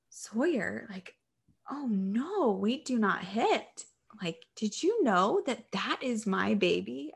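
imitated grandma's aghast reproof; shocked scolding. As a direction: scold a small child in mild shock, pitch swooping. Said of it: "my mom kind of gasped and said".

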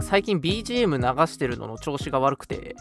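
normal talking voice

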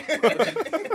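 short high laugh; quick burst of laughter. Real, not acted. Laughing at a friend's blunt disbelief.

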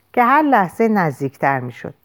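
ordinary delivery, nothing marked

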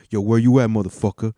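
loud mock Kanye call; a hint of an angry Kanye yelling; imitating Kanye. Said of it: "[name] is like".

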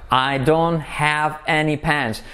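slow, fully pronounced "don't"; deliberate teacher's enunciation; careful model. Shown as a demonstration. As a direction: say I DON'T HAVE ANY PENS slowly, word by word. Careful model before linked take.

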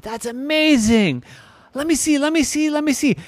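put-on very high, thrilled little-kid voice; excited child. Irene watching the bubble grow, amazed and eager. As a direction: repeat it high and eager, hardly waiting.

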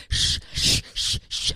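unvoiced shushing. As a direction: hush her with a run of shh sounds. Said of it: "he'd be like".

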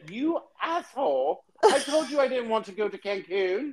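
a hammed-up British accent; mock British wife scolding. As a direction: scold the husband like a stranded tourist wife. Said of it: "the wife was looking at the husband like".

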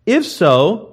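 high, loud, preached-out reading; forceful scripture reading. Real, not acted. Preacher reading the verse to the congregation, proclaiming.

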